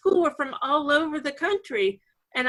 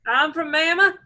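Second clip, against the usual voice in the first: put-on Alabama accent. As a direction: play the Alabama local with a Southern twang. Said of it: "get rid of a few syllables".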